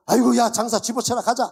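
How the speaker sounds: a hammed-up Chungcheong merchant storming off; 충청도 장사꾼처럼 버럭; mock indignant Chungcheong seller. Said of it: "충청도 분 장사하는 거".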